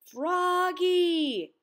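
long, high holler of a name; loud yell. A narrator's reading. Max to his friend on the bus, loud yell. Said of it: "yelled his friend Max".

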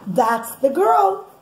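ordinary delivery

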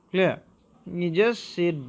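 normal talking voice

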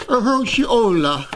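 Hershey-ola with a playful mock-Italian ending, high and slow; mock European twist. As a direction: stretch out Hershey-ola like a fake European brand name. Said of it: "let's just say".

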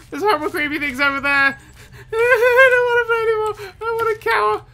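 very high, loud half-laughing wail; panicked laughing shriek. Real, not acted. Player escaping creepy robots, high laughing panic. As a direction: squeal in mock despair while laughing.

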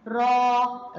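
slow, held را; long vowel demo. Shown as a demonstration. Teacher repeats the sound for pupils, drawn-out را. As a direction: sustain the aa of را. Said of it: "سمعنا صوت المد".

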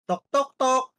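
a knock at the door, spoken aloud. Acted. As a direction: say tok tok tok like knuckles rapping a door.

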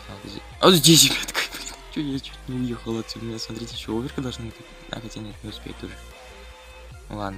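normal talking voice

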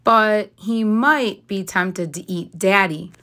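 ordinary delivery, nothing marked

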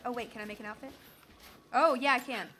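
ordinary talk, nothing marked

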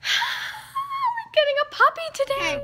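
high, tearful, barely holding it in; overwhelmed with joy about the puppy; teary excitement. Real, not acted. Vlogger telling viewers she's getting a puppy, teary and high.

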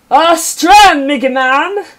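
loud, high exclaiming voice; hammed-up exclamation. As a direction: throw it out like a hammy hero. Reading game text in a voice.

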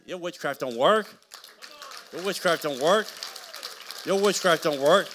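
loud, rising, defiant chant; defiant preaching shout. Real, not acted. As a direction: throw it back at the witches. Repeated taunt at witches.

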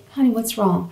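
soft, gentle retold question; hushed, caring inquiry; tender concern. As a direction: ask it softly to comfort someone crying. Retelling comforting a crying man.

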